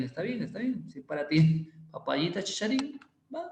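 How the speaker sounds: ordinary talk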